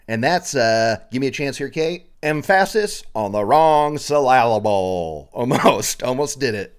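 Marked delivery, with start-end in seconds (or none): 2.2-5.3 slow, "syllable" stressed on its second syllable; deliberately misplaced stress. Shown as a demonstration. Podcaster attempts the joke phrase for Kate, stress wrongly on the middle of "syllable". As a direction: stretch "syllable" as suh-LAL-uh-bul, fumbling it. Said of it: "why can't I say".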